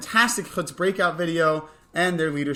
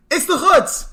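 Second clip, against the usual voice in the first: big high shout of the name; excited announcement; 11 semitones higher, 8 dB louder, slower than usual. Acted. Host hyping the Chutz to campers, loud high cheer. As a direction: announce it like a game show reveal.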